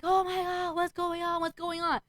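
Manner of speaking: high-pitched mock panic; retold freak-out. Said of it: "I'm freaking out".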